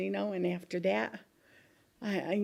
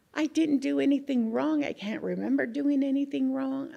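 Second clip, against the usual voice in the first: loud, higher-pitched protest of innocence; indignant protest; 5 semitones higher, 5 dB louder than usual. Retold or imitated. Miner tells interviewer she did nothing wrong, indignant protest. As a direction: plead innocence with rising force.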